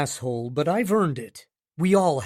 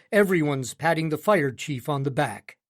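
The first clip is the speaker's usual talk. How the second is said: plain reading voice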